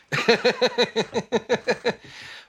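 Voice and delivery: long, very high staccato laugh; big cackling laugh. Real, not acted. Guitarist laughing after calling someone a wagon, high cackle. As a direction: crack up at your own bluntness. Laughs at own blunt remark.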